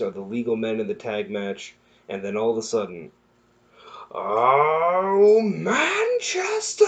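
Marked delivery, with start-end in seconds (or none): 4.1-6.8 high drawn-out shout of the city name; stretched stadium yell; mock crowd shout. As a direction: belt it like a cheering crowd. Mock crowd cheer recap.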